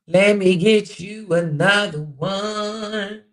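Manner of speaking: drawn-out singsong. Real, not acted. Host stalling viewers while hunting for another quote, drawn-out singsong. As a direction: draw every word out while searching.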